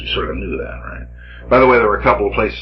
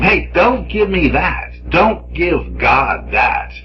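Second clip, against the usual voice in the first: Jeremiah; 9 semitones higher, slower than usual. Acted. Jeremiah scolding the people.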